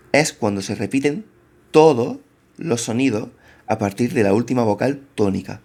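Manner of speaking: normal talking voice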